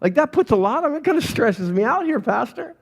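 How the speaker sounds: stressed congregant. Acted. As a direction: protest to the pastor, pitch climbing with worry. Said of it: "Maybe you're honest, you're like".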